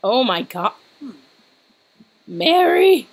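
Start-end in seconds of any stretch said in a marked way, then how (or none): 2.3-3.1 high, loud sudden realization; excited shriek. Real, not acted. Gamer shrieks at realizing the joke, loud and high. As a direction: yelp with delight as it clicks.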